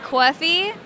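"coffee" with an AW vowel, as if spelt C-A-W; respelled accent pronunciation. Shown as a demonstration. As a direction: stretch "coffee" into a drawn-out "cawfee". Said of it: "I respell words like".

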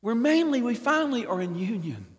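normal talking voice

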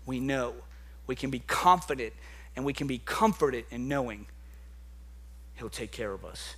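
hushed comforting pastor; soft reassurance. Acted. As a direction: ease down gently to comfort the room. Soft reassuring close after preaching.

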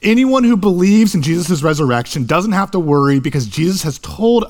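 preaching. Acted. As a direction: drive the point home to the congregation. Preacher builds to full force.

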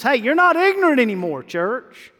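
fast, high, loud call to the church; preaching call-out. Real, not acted.

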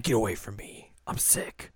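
hushed, breathy whispered warning; sick girl whispering. Retold or imitated. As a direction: whisper to keep her away. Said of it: "And the girl's like".

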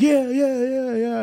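high, loud party DJ hype; hyped DJ. Retold or imitated. Storyteller imitates the club DJ hyping the crowd, high and loud. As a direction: pump up the crowd like a cheesy nineties DJ. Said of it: "he's like".

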